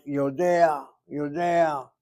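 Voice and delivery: Yodea with the Ayin caught in the throat; Yodea, a throaty old-style Ayin; old Ayin pronunciation. Retold or imitated. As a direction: say Yodea as it was said back in the day. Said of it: "back in the day, it was like".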